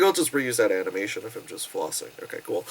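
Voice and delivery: low mumbling mock voice; deep lazy deadpan; lazy animators. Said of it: "they're like".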